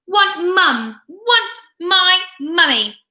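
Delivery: put-on a baby crying for mummy; wailing toddler. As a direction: draw out the toddler's whine. Toddler wailing for her mother.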